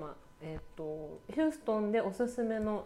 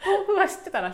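high-pitched laughing aside; squeaky laugh through words; 11 semitones higher, 11 dB louder than usual. Real, not acted. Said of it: "laugh cry".

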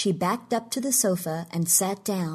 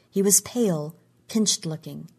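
ordinary delivery, nothing marked

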